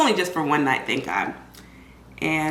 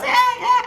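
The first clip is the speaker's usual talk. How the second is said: mock baby fuss; 5 dB louder than usual. Imitating the baby crying.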